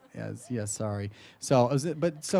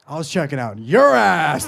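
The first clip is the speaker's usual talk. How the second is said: put-on loud, bold drunk declaration; drunken confidence; 8 dB louder than usual. Drunk man looks a dude in the eyes, drunken confidence. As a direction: blurt it out loudly with boozy confidence. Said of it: "so with full drunken confidence".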